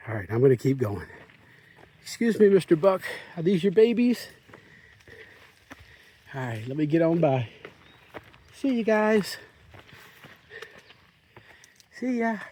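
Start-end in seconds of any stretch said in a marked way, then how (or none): 2.0-12.4 higher, playful voice for the buck; sweet pet talk. Real, not acted. Politely addressing the buck.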